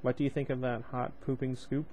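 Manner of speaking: ordinary delivery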